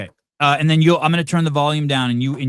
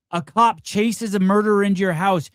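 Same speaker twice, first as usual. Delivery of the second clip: ordinary talk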